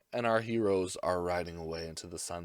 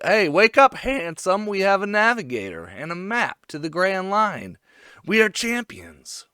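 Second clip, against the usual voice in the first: high, loud mock hype; excited retold announcement; 6 semitones higher, 5 dB louder than usual. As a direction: rouse a friend with big news. Retold excited wake-up call.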